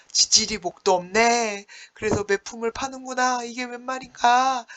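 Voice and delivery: mock lament. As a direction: wail that he must sell himself to be beaten. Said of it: "이러면서 막 울면서 가요".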